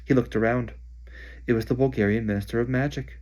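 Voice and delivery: usual speaking voice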